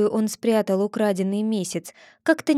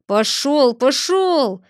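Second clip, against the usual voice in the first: loud shout, higher; 4 semitones higher, 5 dB louder, much slower than usual. A narrator's reading. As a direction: drive him off with a shout. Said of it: "закричал кузнец". (кузнец громко гонит Чуба)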